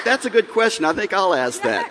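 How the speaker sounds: high, laughing mock of his wife's eager voice; mimicking wife, amused. Husband laughing while mimicking his wife to the audience, high mock voice. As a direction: play the wife pouncing on the question, laughing. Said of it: "Lynn, Lynn's like".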